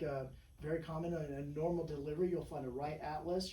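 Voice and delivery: normal talking voice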